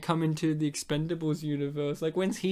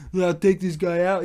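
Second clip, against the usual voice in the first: half-hearted tough-guy impression, louder; badly faked action-hero voice; Stallone; 6 dB louder than usual. Action star threatens the stranger, poorly imitated. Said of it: "I can't do a Stallone".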